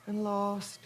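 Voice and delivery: dreamy, faraway. Acted. Mother drifts off dreamily.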